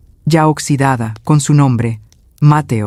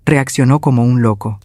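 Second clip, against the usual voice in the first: plain reading voice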